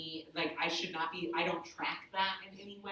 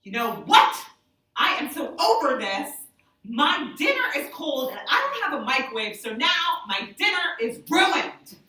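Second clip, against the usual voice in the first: loud, high, fed-up complaint; exasperated rant; 7 semitones higher, 11 dB louder than usual. Retold or imitated. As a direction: blow up about the cold dinner, slowing at the end. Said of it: "the deaf woman goes".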